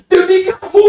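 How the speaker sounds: high, hammed-up vow of love; mock lovestruck suitor. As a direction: swear devotion like a smitten lover. Mocking a suitor's grand promise.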